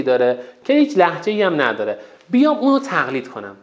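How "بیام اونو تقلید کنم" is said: ordinary talk, nothing marked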